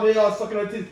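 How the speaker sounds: normal talking voice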